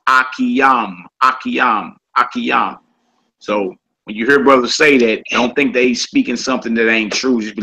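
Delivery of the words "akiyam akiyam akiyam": Akiyam said ah-kee-AHM; vowelless-style pronunciation. Shown as a demonstration. Teacher showing how other brothers say it, Akiyam as ah-kee-AHM. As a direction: say "Akiyam" in three syllables ending in open AHM. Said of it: "that don't use vowels, you hear them say".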